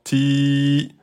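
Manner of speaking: "Ti" with the i held for two beats; long i in two beats. Shown as a demonstration. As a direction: stretch the i of "Ti". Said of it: "là vous êtes sur du deux temps". (« Ti » tenu deux temps)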